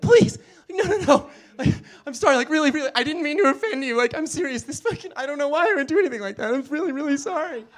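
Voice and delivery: hammed-up panicked begging with laughter breaking in; indignant horror. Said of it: "like, indignant horror".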